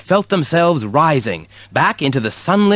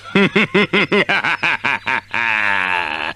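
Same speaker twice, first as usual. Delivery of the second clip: wicked cackle. A narrator's reading. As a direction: cackle like an evil sorcerer. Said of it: "He cackled wickedly".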